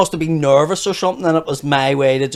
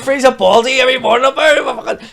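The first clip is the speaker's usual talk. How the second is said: loud, high, laughing site-worker rant; laughing through a mock tirade at the chapel women; mock builder rant; 8 semitones higher, 7 dB louder than usual. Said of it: "and I'd go and go".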